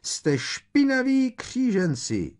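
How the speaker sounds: very high, loud drawn-out screech. A narrator's reading. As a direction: screech the insult slowly at full pitch. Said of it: "vřískala Umbridgeová". (vysoký, hlasitý vřískot)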